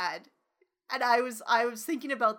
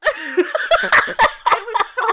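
loud, high wail through laughter; laughing outburst; 9 semitones higher, 15 dB louder, much slower than usual. Real, not acted. Host lamenting her cursed knowledge, laughing outburst. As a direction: drag it out, helpless with laughing.

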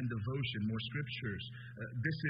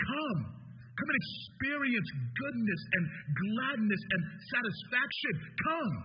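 rising preaching; 7 semitones higher than usual. Acted. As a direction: build the invitation until it peaks. Preacher builds the call to come.

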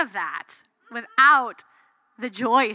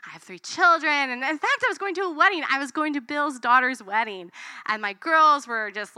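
ordinary talk, nothing marked

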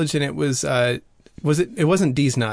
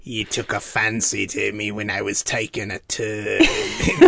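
creepy homeless man; 6 semitones lower than usual. Retold or imitated. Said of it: "He's like".

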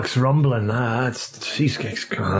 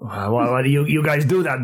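normal talking voice

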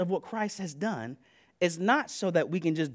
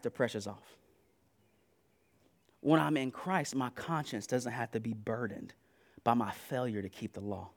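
soft reassurance; 9 semitones lower, 8 dB quieter than usual. Real, not acted.

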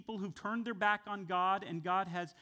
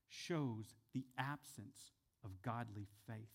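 hushed sermon point; 5 semitones lower, 6 dB quieter than usual. Real, not acted. Preaching soft key point.

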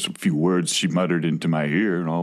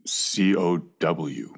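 slow spelling; 4 semitones lower, much slower than usual. Acted. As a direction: spell each letter very slowly. Cow spells its name slowly.

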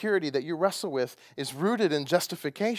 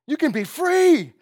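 loud and slow, the peak of the build; rising shout; 11 semitones higher, 10 dB louder, slower than usual. Real, not acted. Preacher shouting to the church about freedom, loud and slow. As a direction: cry it out to the congregation.

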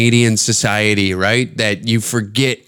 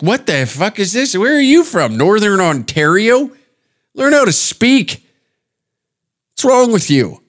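high-pitched comic disbelief; mock exasperation; 7 semitones higher than usual. Comic berating the lady's speech, mock exasperated. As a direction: demand it in mock outrage, pitched way up. Acted outburst at the accent.

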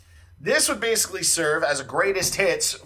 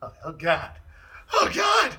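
mock dismay; 6 semitones higher than usual. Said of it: "And then he'd go".

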